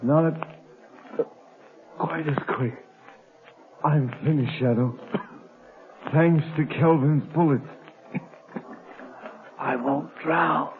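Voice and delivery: fading out, each word an effort; low, failing voice of a wounded man; dying and coughing. Acted.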